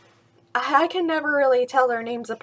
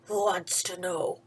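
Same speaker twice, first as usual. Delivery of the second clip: lower, gruff voice from inside; low growl; 6 semitones lower, 6 dB quieter than usual. Acted. Hidden stranger challenging Skippy, low growl. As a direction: growl a warning. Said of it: "growled a voice from inside".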